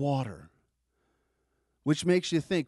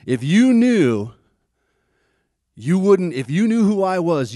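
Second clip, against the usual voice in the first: emphatic Jesus; 5 semitones higher, 7 dB louder than usual. Acted. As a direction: press each word home as Jesus insisting. Jesus voiced pleading with woman.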